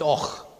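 Och as one syllable with a throaty ch; local pronunciation. Shown as a demonstration. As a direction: say Och like Scottish "och". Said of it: "that's just pronounced Och".

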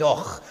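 Och said as a single syllable; local pronunciation. Shown as a demonstration. Explaining what locals call the village, Och pronounced as one syllable. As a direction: end Och on a Scottish ch. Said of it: "all the locals just call it Och".

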